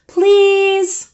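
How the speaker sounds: high, pleading please; child pleading; begging. Acted. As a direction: beg like a little boy. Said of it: "he begged".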